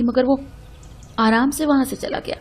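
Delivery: ordinary delivery, nothing marked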